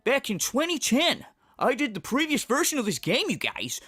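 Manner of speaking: hammed-up show opener; Phil impression. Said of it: "And then he'll go".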